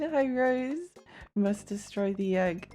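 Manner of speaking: ordinary delivery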